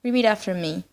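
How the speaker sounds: usual speaking voice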